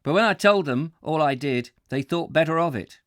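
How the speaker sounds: a clumsy Geordie railwayman; attempted Geordie accent. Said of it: "crap at the accent".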